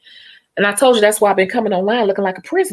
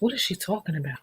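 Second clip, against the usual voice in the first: "What is she talking about?" murmured much softer; soft aside; 10 dB quieter than usual. Acted. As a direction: mutter it to yourself. Mocking aside at the viewers.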